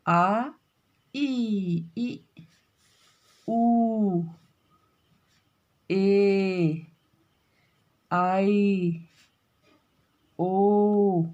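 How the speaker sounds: आ ई ऊ ए ओ each drawn out long; stretched-out long vowel demo. Shown as a demonstration. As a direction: hold each vowel for a full two beats. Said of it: "थोड़ा सा और ज़्यादा समय लगता है".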